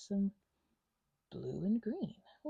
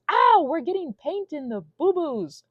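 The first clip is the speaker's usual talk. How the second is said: pained ow; 7 semitones higher, 9 dB louder, slower than usual. Real, not acted. Paint in a scrape.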